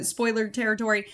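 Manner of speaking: normal talking voice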